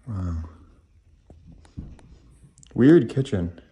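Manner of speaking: ordinary talk, nothing marked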